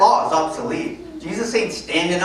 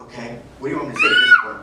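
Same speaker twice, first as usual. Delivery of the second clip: much higher, louder, fast exasperated yell; exasperated shout; 3 dB louder, much faster than usual. Retold or imitated. Answering the accusing cop, mock exasperated yell. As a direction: throw up your hands in mock outrage.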